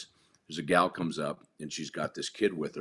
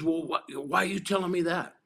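baffled; 12 semitones higher, 5 dB louder than usual. Retold or imitated. Kid baffled by the advice.

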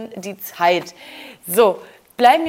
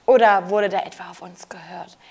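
sly whispered aside; whispering; 5 semitones lower than usual. Real, not acted. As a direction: whisper it like a secret joke. Sly aside between two hosts.

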